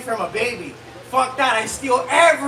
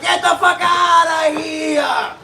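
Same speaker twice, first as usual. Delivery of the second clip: raised, slow, stretched-out yell; brash mock shout; 4 semitones higher, 4 dB louder, slower than usual. Comic to someone hanging for dear life, brash shout. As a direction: holler them away with exaggerated force. Mock outburst in pro-choice joke.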